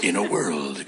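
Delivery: deep, low trailer narrator; booming "in a world" announcer; movie trailer voice. Pretend.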